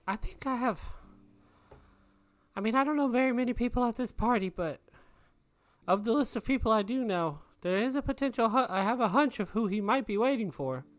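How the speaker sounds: tentative hunch in a high character voice; high-pitched character voice. Acted. Player speaks as their character.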